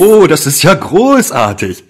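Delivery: delighted. Acted. Good news: sticks have arrived.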